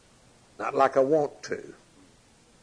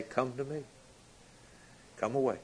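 soft call; 10 dB quieter than usual. Acted. Voice of Jesus heard calling.

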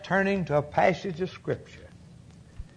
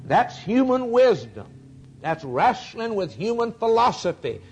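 full-force preaching; 3 semitones higher than usual. Acted. Preacher answering a caller before the congregation, full-force preaching. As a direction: preach it out, building to the verdict. Preaching against worldly wisdom.

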